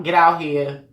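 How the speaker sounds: normal talking voice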